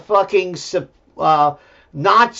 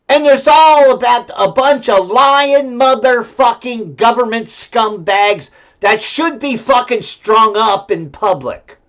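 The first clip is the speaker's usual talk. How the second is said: angry rant; 5 semitones higher, 5 dB louder than usual. Real, not acted. Rant about government scumbags.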